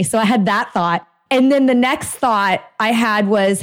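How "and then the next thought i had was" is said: usual speaking voice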